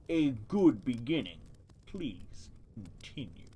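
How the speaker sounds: a lightly deepened slow turtle voice from the mud; deep voice. Acted. Turtle in the mud lecturing the mice, lightly deepened voice. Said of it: "give it a deep voice".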